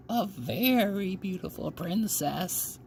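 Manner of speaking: put-on high, slow, hushed old storyteller; slow storytelling old woman. A narrator's reading. Grandmother opens the bedtime tale to the girl, slow and hushed. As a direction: draw it out like a spell.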